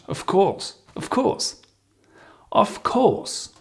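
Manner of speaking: "Of course" pitched very high; high pitch intonation. Shown as a demonstration. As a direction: sing "course" out high and friendly. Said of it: "Can you hear the intonation?".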